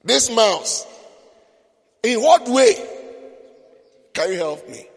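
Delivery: loud, scoffing lion voice; the lion scoffs loudly at the mouse; scornful lion. Acted. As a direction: sneer down at the tiny mouse, booming. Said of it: "And the lion was like".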